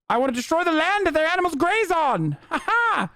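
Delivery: high-pitched villain with a hammed-up transatlantic accent; Umberto, cartoonish villain. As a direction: crow it like a moustache-twirling cartoon baddie. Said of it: "these cartoonish villains".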